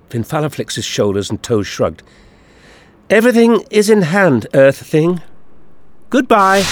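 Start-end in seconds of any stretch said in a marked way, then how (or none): 3.1-6.6 high, triumphant character voice; gloating alien villain. A narrator's reading.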